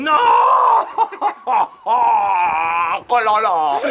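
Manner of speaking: loud laughing protest; laughing shout. Real, not acted. Host reacts to Salvini answer. (urlo tra le risate)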